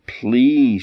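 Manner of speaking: high, drawn-out plea; pleading. A narrator's reading. Queen begs the little man for her baby, pleading. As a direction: beg with the whole word. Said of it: "she begged and pleaded".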